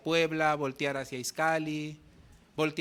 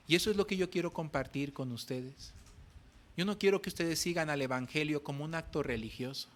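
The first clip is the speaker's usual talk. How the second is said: usual speaking voice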